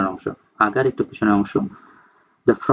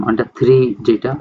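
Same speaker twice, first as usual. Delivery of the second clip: ordinary talk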